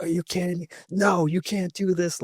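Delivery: high-pitched mock outrage, slowing on the refusal; retold fury. Recruiter to the newlyweds in wedding clothes, mock furious. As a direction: imitate an indignant refusal, pitched well up.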